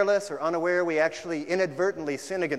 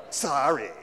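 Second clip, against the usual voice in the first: grudging sorry; 4 dB louder than usual. Retold or imitated. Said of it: "we can repent that way".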